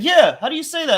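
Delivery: mock eager friend, voice up high; excited question. Friend wanting the Russian phrase, eager and high. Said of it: "He's like".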